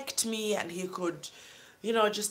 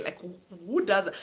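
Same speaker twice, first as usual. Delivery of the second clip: ordinary talk, nothing marked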